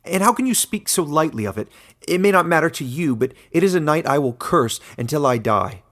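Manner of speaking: razor's edge. Acted. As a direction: spit out the curse with a cutting edge. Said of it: "the razor's edge in his voice".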